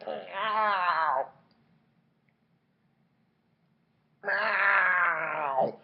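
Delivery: drawn-out imitation cat cry; pained puma meow. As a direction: yowl like a suffering cat. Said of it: "it's all like".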